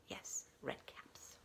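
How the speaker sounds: slow hushed whisper. A narrator's reading. Storyteller whispers the last cap to children. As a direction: breathe it out slowly in a whisper.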